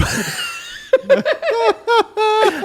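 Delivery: big high burst of laughter; real laughing outburst; loud laughing shout. Real, not acted.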